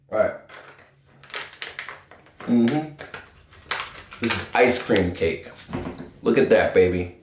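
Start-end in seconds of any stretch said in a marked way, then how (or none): none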